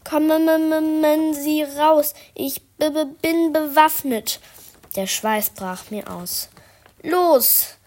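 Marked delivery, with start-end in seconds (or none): 0.0-4.4 high, loud and slow, stuttering bluff; scared child threatening the intruder with a broom; shaky brave threat. Acted. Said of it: "Aber sie zitterte noch immer". 7.0-7.7 scared shout. Acted. Threatening the figure with a broom.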